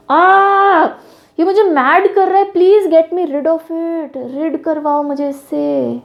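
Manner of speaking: mock pained cry about the pen; acting in pain. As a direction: ham up a pained plea. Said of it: "I'm a good actor by the way".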